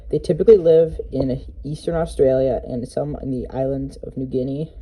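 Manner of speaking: ordinary talk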